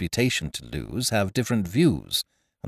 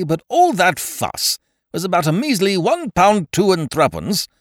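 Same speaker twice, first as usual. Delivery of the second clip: indignant Ukridge; 9 semitones higher, 5 dB louder than usual. A narrator's reading.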